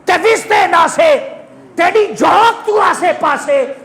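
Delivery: high, declaimed Saraiki couplet; fervent pulpit poetry, pitched high; impassioned verse recitation. Real, not acted. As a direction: recite the verse in a ringing preacher's voice.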